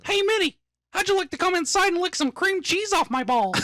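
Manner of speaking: very high falsetto mouse voice; squeaky cartoon come-on; cartoon mouse impression. Crude Mickey Mouse impression.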